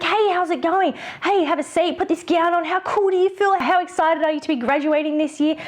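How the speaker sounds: high, upbeat photographer patter; excited greeting. Shown as a demonstration. Photographer welcoming a graduating child, excited. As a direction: amp up the fun for the kid. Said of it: "by being excited and be like".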